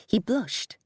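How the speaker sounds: ordinary delivery, nothing marked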